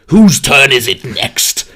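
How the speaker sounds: slow, booming gatekeeper call. Retold or imitated. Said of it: "he says, like".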